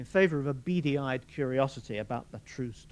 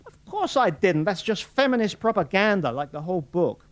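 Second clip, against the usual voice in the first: louder, higher, fast blustering man; blustering Morris; 7 semitones higher, 8 dB louder than usual. A narrator's reading. Morris fends off Fulvia in loud, fast bluster. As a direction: brush her off with quick bluster.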